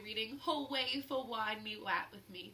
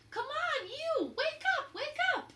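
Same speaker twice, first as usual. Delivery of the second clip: much higher, louder urgent yells; yelling at leaves; 6 dB louder than usual. A narrator's reading. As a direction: rouse the sleeping leaf.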